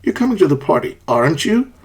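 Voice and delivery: "aren't you" rising at the end; really ask, lifting "aren't you"; questioning upward tune on "aren't you"; unsure tag question. Shown as a demonstration. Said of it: "because you do not know".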